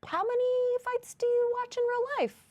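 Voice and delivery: very high-pitched disbelief; incredulous. Real, not acted. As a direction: squeak the question out, astonished at the boast. Incredulous question to co-host's boast.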